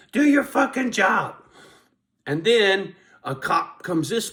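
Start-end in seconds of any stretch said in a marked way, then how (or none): 0.0-1.4 high, loud taunting shout; mock yell at cop. Shouting at a cop driving by, angry taunt. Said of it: "I'm like".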